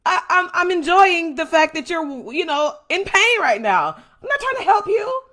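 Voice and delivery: gloating enemy. Retold or imitated. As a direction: taunt the beaten victim, cackling. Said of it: "They're gonna be like".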